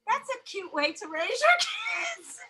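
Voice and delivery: high, slow voice of a tickled shop lady; shop lady impression. Store clerk remarking on mother and kids, high slow mimicry. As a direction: draw it out high as an onlooker.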